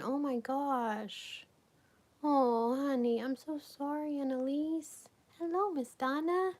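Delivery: light up as a friend joins the chat; excited greeting. Real, not acted. Greeting chatters on live stream.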